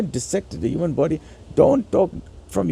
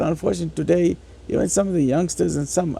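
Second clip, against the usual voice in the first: normal talking voice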